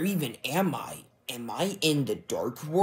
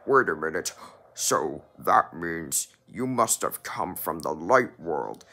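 deep villain voice; 6 semitones lower than usual. Acted. Dark double schemes.

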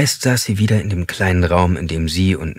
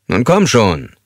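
impatient; 3 semitones higher, 4 dB louder, slower than usual. A narrator's reading. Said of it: "eine gewisse Ungeduld in seiner Stimme".

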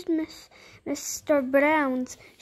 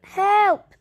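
loud, high cry for help; a bear's loud shout for rescue; calling out for help; 7 semitones higher, 7 dB louder than usual. A narrator's reading. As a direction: call out sharply, raised pitch and volume. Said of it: "Paddington tried calling out".

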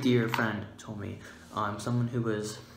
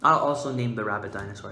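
ordinary talk, nothing marked

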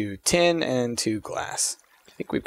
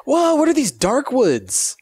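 surprised; 14 semitones higher, 5 dB louder, slower than usual. Real, not acted. Surprised at strange trees.

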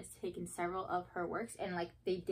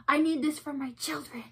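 excited gasp; 6 semitones higher, 6 dB louder than usual. Retold or imitated. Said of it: "being like".

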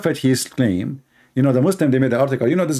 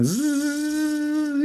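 imitated pissing noise; 13 semitones higher, 6 dB quieter than usual. Shaitan pissing in mouth.

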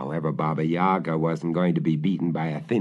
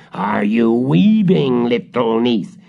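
put-on slow, louder old witch call; witch calling sweetly; 3 semitones higher, 7 dB louder than usual. The witch calls up to her niece below the window.

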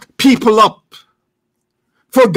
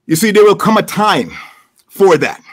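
ordinary talk

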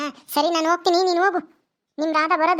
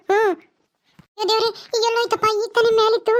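ordinary talk, nothing marked

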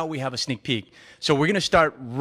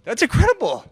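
amazed; 10 semitones higher, 3 dB louder than usual. Real, not acted. Amazed watching brick break.

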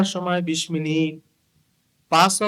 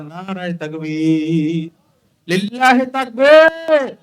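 rallying slogan shout; 8 dB louder, slower than usual. Real, not acted. Preacher leading raised hands in takbir, building shout. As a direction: rouse the whole crowd with a drawn-out cry.

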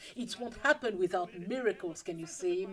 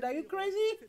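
high, rising mock disbelief; incredulous retort; 10 semitones higher than usual. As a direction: squeak it out in disbelief. Jesus refuses to be made king.

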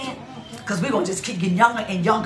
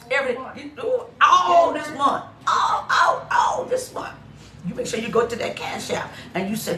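ordinary talk

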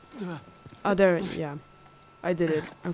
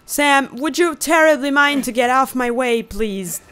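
raised, exasperated request; mock-polite plea at higher pitch and volume; annoyed; 4 semitones higher, 5 dB louder than usual. Real, not acted. Player to brother in the way, annoyed demand. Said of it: "This is so annoying".

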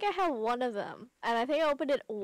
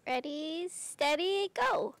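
excited race start; 4 semitones higher, much slower than usual. Acted. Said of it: "game voice acted by [name] and [name]".

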